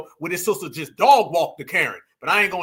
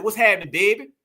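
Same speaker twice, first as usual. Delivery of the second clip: regional Southern accent; 4 dB louder than usual. Shown as a demonstration. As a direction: demo of a Southern regional accent, lively rising end. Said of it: "tell me in the chat where this accent comes from".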